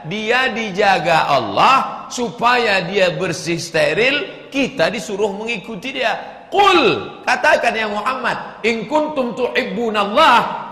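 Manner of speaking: high, loud sermon build; fiery preaching. Acted. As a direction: drive the point home to the crowd. Preaching at full force.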